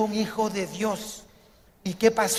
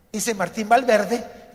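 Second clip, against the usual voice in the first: ordinary delivery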